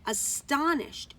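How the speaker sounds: plain reading voice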